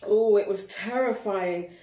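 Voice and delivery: plain reading voice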